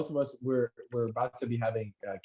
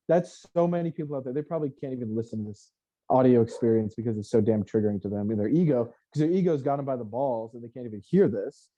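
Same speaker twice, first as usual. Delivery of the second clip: ordinary delivery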